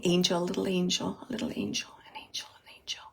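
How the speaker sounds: starts softly and fades to a whisper; tender repetition dying to a whisper; fading to a whisper. Retold or imitated. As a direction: let each angel get fainter until it is only breath. Relays spirit calling baby angel.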